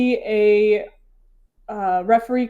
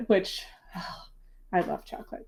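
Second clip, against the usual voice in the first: breathy whispered aside; confiding murmur to the audience; 10 dB quieter than usual. Real, not acted. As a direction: drop to a secret whisper. Aside whispered about chocolate.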